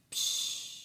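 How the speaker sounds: unvoiced hissed psh like a hi-hat; quiet breathy cymbal noise; imitated cymbal. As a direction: mimic a soft drum hit. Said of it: "the drummer would go".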